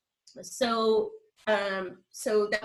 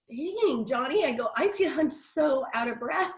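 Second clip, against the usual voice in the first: 1 retold breathless self; 6 semitones higher than usual. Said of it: "And I'm like".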